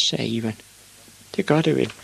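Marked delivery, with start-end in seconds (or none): 1.4-1.9 put-on high little boy's voice; resigned high-pitched child. A narrator's reading. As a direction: agree meekly in a high voice. Small Ivan gives in.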